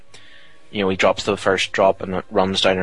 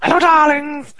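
high, loud, camp greeting; campy drag queen; 6 dB louder than usual. Retold or imitated. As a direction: gush it out like a diva. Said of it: "Looks like you".

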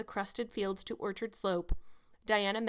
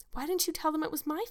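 high-pitched, insistent question; demanding; 7 semitones higher than usual. A narrator's reading. Anne challenges Diana over the blame, demanding. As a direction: press her for an explanation. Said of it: "demanded Anne".

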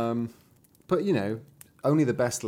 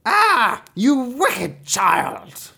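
high, loud witchy outburst; enchantress's cry; 12 semitones higher, 8 dB louder, much slower than usual. A narrator's reading. Enchantress raging at Rapunzel, loud drawn-out cry. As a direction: cry it out long and furious. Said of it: "cried the enchantress".

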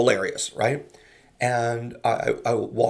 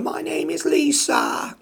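put-on very high, slow child voice; little girl; slower than usual. Comedian voicing a little girl answering on stage, high and slow. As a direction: say it like a shy six-year-old. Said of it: "She said".

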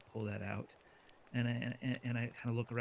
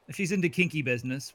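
excited joking; 4 semitones higher, 6 dB louder than usual. Acted. Joking character suggestion.

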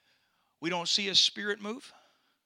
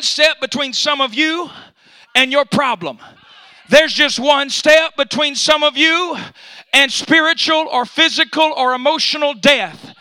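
louder, higher, driving preacher build; rising preaching; 4 semitones higher, 11 dB louder than usual. Acted. Pastor building up to the congregation. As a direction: start climbing to the climax. Sermon climbing toward the climax.

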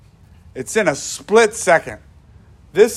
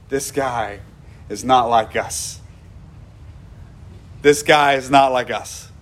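1 thief's realization; 5 semitones lower than usual. Retold or imitated. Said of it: "One of them goes".

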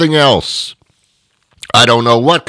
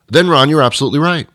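quick concession in a raised, ordinary-volume voice; imagined admission; faster than usual. Acted. America waking up to agree with the host, quick admission. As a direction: concede the point as the imagined public. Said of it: "for America to wake up and say".

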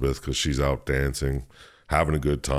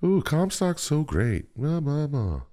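mocking gushing fan; 12 semitones higher, slower than usual. Mocking Comstock's adoring followers.

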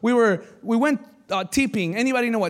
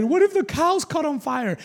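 put-on higher, worried girl's voice; scared girl; 6 semitones higher than usual. Retelling the scared girl's worry, higher pitched. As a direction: play the frightened teen girl. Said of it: "one of the girls got scared".

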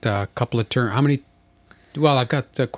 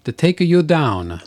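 hammed-up, higher mock Italian accent; 5 semitones higher, 5 dB louder than usual. Threatening to take the opponent down, mock Italian accent. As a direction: boast in badly faked singsong Italian. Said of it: "is that my Italian accent?".

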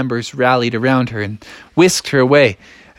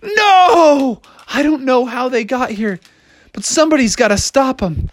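high, melodramatic wail of alarm; dismayed frog; 9 semitones higher than usual. Acted. Froggy dismayed by ogres.

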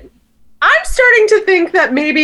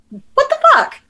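mock disbelief; 13 semitones higher than usual. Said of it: "I'm like".

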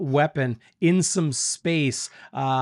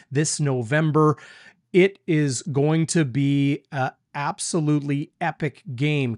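ordinary talk, nothing marked

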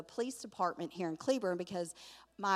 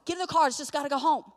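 exasperated order; 5 semitones higher, 5 dB louder, faster than usual. Retold or imitated. Exhausted grandma ordering kids into car.

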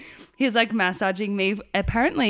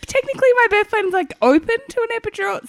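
high, pleading re-enactment; begging; 4 dB louder than usual. Retold or imitated. Mother in labour begging staff for an epidural, high mock plea. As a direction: push the pitch up as a mock plea. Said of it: "begging them for an epidural".

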